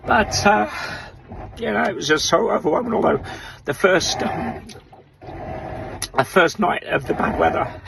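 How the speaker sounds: talking through rising emotion; fighting back tears. Real, not acted. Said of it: "I'm getting emotional".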